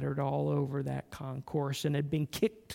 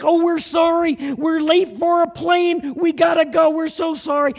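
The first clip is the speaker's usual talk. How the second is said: called back much higher and louder; a rushed traveller's loud sorry; shouted hurried apology; 12 semitones higher, 13 dB louder than usual. Retold or imitated. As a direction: yell it over your shoulder while running.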